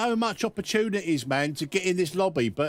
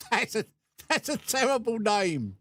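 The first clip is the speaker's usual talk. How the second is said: laughing; 4 semitones higher than usual. Real, not acted. Laughing at a bad gamer name.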